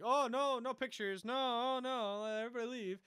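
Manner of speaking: high, loud mock plea; flustered refusal. Andor to journalists crowding her, high-pitched mock protest. Retold character waving off journalists.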